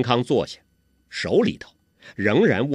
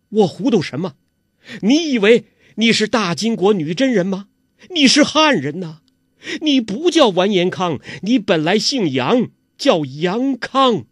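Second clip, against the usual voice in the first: impassioned mother; 8 semitones higher, 6 dB louder than usual. Acted. Mother reveals son's true identity.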